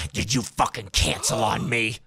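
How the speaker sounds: slow, menacing driver impression; angry driver. Jilted Uber driver calling the rider who canceled, angry. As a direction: confront the rider, drawing out the accusation.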